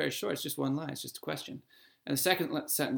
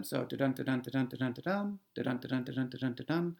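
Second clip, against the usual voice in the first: da-dun repeated five times, soft da, stressed dun; iambic pentameter demo; 2 semitones lower, 4 dB quieter than usual. Shown as a demonstration. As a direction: chant the beat steadily and softly, stress on dun. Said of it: "pentameter means five of them".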